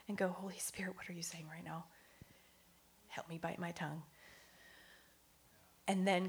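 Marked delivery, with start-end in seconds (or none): 0.3-4.0 very soft, under-the-breath plea; hushed private prayer. Acted. Woman pausing mid-frustration to ask Holy Spirit for help, hushed prayer. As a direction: pray quietly to yourself, well below speaking volume.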